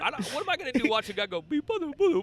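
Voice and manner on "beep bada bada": high squeaky gibberish, slowing down; mock Dutch gibberish. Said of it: "That's how they all talk to me".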